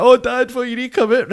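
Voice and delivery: high, loud mock wail; imitating greeting child. Wee boy screaming for his dad outside the bookies, mock crying. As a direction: cry out like a panicked wee boy, half-acted. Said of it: "pure greeting, 'cause I'm a wee boy".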